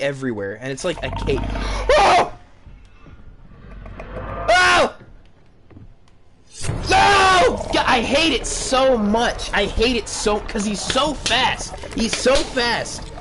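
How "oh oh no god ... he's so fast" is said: high loud screaming panic; terrified scream. Real, not acted. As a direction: scream while being chased. Monster chase, screaming.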